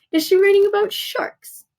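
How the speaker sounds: a hammed-up gasp of interest; mock excited guess. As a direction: pop up with fake excitement about sharks. Teacher mock-guessing paragraph topics.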